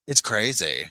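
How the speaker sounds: ordinary talk, nothing marked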